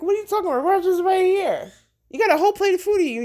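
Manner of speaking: high, fast teasing voice; amused God. Retold or imitated. God teasing you about the food, high and fast. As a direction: tease the starving kid. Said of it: "And he's like".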